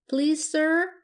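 high-pitched sweet pleading. A narrator's reading. Blanche asking the man for apples, sweetly. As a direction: ask very sweetly, voice lifted. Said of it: "said Blanche sweetly".

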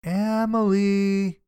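long, high sing-out of a name; calling her name. A narrator's reading. Name called to girl in the tub, drawn-out call. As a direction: draw the name out as a call through the house. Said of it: "I heard my name being called".

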